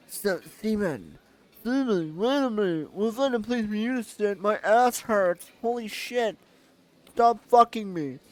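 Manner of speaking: high character voice. Acted. Voicing Olga again.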